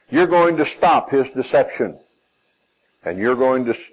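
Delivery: normal talking voice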